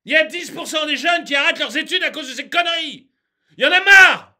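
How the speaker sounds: fast complaint bursting into a shout; worked up. Real, not acted. Ranter complains of students dropping out, exploding in exasperation. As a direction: rush it, then explode, fed up. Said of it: "je m'énerve".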